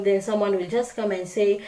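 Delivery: usual speaking voice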